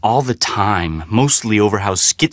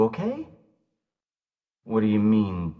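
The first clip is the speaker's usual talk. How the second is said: normal talking voice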